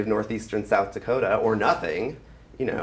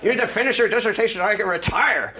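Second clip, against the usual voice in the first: gruff bulldog of a professor, pitched up; growly bulldog voice; 11 semitones higher, 5 dB louder, faster than usual. Retold or imitated. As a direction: bark it like a stern old bulldog. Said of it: "really kind of growly sort of bulldog voice".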